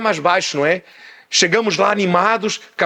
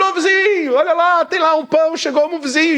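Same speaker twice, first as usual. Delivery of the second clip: high-pitched call at a window; urgent, acted calling out; 8 semitones higher than usual. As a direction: call up to the neighbour to get him up. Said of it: "ia bater na janela e dizer".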